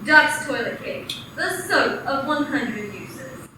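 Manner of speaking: usual speaking voice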